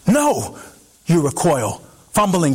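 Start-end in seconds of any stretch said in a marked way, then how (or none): 0.1-0.6 panicked no. Acted. You recoil from the healer.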